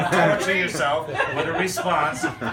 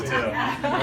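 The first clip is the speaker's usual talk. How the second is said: usual speaking voice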